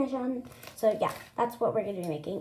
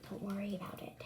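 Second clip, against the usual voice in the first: whispering; 10 dB quieter than usual. Retold or imitated. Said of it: "And I'm like".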